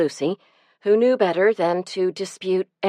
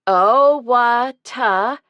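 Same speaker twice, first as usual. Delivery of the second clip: long, stretched chant syllables; drawn-out syllables; 6 semitones higher, 5 dB louder, much slower than usual. A narrator's reading. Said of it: "drawing out each syllable".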